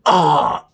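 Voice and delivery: a loud exasperated ugh; loud disgusted groan. Real, not acted. Disgust at the plot twist.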